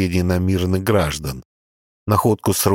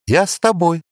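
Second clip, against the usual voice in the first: put-on girl's voice; 10 semitones higher than usual. A narrator's reading. Girl joins him.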